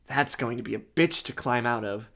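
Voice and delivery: plain reading voice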